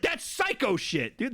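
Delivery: ordinary delivery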